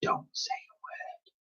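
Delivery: unvoiced whisper, slow; mimed whisper. A narrator's reading. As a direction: breathe it with no voice. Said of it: "to mime".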